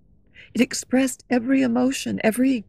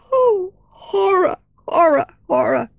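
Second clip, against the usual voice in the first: horrified cry; 8 semitones higher, 4 dB louder than usual. Acted. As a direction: scream out in horror at the sight. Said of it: "the cry of pain and anger".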